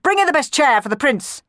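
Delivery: high, loud order; yelling. A narrator's reading. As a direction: bellow orders at a servant. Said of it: "he yelled".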